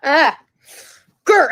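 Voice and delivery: playful angry growl; Hulk growl. Real, not acted. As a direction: growl like the Hulk to hold back tears. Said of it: "I started doing that to make myself, like, not cry".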